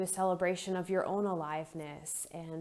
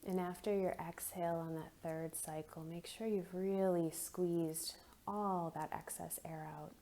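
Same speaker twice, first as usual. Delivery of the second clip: soft, unhurried meditation voice with long pauses; guided meditation; 6 dB quieter than usual. Acted. Leads a guided breathing meditation.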